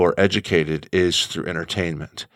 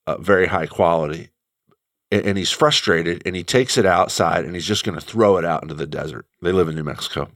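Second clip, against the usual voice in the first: ordinary talk, nothing marked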